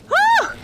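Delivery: high, loud startled yelp; panicked yelp. Real, not acted. Panicked in boss fight.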